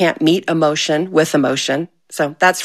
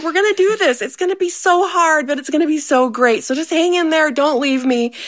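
higher, gently encouraging, amused; reassuring husband; 9 semitones higher than usual. Retold or imitated. Husband hugging his crying wife, reassuring. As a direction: comfort her while half laughing. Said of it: "He's like".

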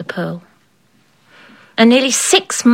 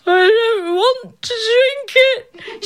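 high, slow crying whine; high wailing protest, drawn out; whining child queen; 13 semitones higher, slower than usual. A narrator's reading. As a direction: refuse like a tearful child.

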